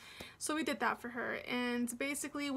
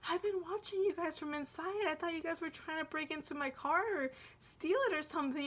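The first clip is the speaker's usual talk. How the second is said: higher, lightly voiced old lady; sweet old lady; 4 semitones higher than usual. Old lady owner tells the couple she saw them, sweet and higher. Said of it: "and she's like".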